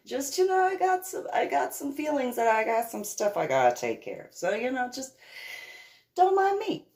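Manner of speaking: airy and high, brushing it off; breezy high voice. Retold or imitated. A daughter brushing off her father, breezy and high. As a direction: sing it lightly so he lets you go.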